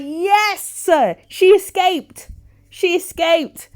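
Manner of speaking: loud high slow cheer; loud high slow joyful cheer. Real, not acted. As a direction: celebrate like she just won. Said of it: "A Jamaican came out of me".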